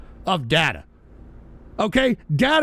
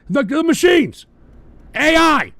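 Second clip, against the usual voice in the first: loud, high outburst; emphatic shouting; 7 semitones higher, 7 dB louder than usual. Real, not acted. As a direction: shout it as an alarmed warning. Indignant rant, hammering the warning home.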